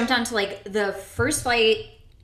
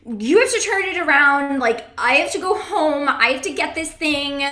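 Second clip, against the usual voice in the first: loud, higher-pitched frantic demand; retold screaming; 5 semitones higher, 7 dB louder than usual. Passenger retelling demands to the taxi driver, screaming. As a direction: raise your voice in a retold panic. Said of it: "started screaming in the taxi cab".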